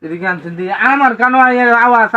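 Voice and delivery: usual speaking voice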